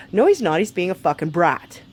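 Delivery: normal talking voice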